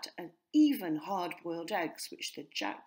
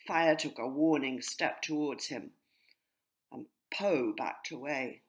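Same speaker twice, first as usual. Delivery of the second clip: ordinary delivery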